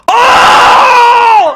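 long, high scream of shock; full-voice ecstatic scream; excited scream. Real, not acted. Collector seeing the last card of the pack, screaming excitedly. Big pull at pack opening.

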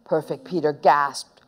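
plain reading voice

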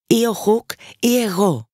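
put-on the boy hero throwing down a challenge, slowly; Peter's defiant vow. A narrator's reading. Peter's oath before the fight.

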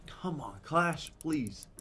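high, slow begging plea; pleading. Real, not acted. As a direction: drag it out like a prayer. Begging for a good hit.

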